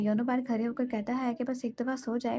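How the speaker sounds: plain reading voice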